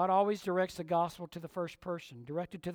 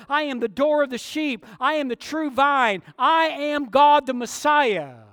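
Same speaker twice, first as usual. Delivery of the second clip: loud, high, ringing "I am" declarations; Jesus booming his titles; Jesus proclaiming; 8 semitones higher, 13 dB louder than usual. Acted. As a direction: thunder each claim from on high. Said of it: "He says".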